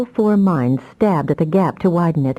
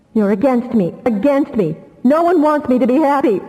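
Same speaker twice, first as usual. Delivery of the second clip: higher hurt cry of betrayal; anguished cry; 7 semitones higher than usual. Acted. Damia accusing her family of standing against her, anguished. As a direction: wail that no one wants you happy. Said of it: "cried Damia".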